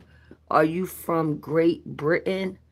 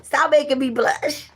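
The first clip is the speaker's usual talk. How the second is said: higher pitch, breaking into laughter; flattered giggle; 7 semitones higher than usual. Real, not acted. Giggles at compliment on accent.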